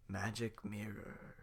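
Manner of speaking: slow unvoiced whisper; awed whisper. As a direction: breathe it out in awe. In awe of the mirror.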